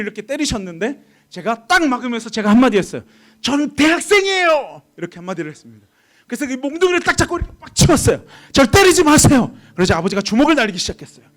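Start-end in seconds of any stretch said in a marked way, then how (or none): 3.4-4.8 high, drawn-out reenacted yell; slow, raised-voice outburst; defiant protest. Son defiantly protests to father. 8.5-9.6 high, slow reenacted plea; forceful, drawn-out objection; loud protest. Son protests father's beating.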